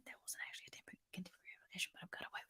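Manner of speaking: secret whispered aside. Real, not acted. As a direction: whisper it like a confession. Confessing aside to the audience.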